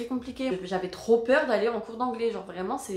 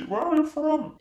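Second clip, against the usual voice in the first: higher-pitched mock local old-timer; imitated old local man; 6 semitones higher, 3 dB louder than usual. Old local man questioning newcomer, mock old-man voice. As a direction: put on a neighbourhood old man's English. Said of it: "un petit vieux du quartier qui m'a dit".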